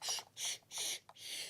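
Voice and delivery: soft unvoiced shh to hush a baby; shushing whisper. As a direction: breathe the shh out like hushing an infant. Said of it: "she's just, just".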